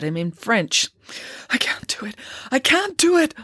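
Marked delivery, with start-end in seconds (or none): 1.5-2.2 softly muttered, slightly raised pitch; muttering. A narrator's reading. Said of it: "muttered Friday". 2.5-3.3 high-pitched, more insistent protest; panicky, high-voiced refusal; distressed protest. A narrator's reading. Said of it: "muttered Friday".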